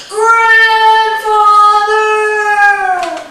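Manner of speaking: high, loud, long-drawn call. Acted. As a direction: holler across a great distance. Boy calls to distant giant.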